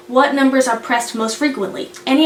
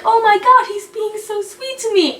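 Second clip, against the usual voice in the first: high-pitched, hammed-up swooning; mock gushing; 9 semitones higher than usual. Captive swooning over his captor's care, mock gushing. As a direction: burst out high, then swoon. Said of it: "Yoon Bum's like".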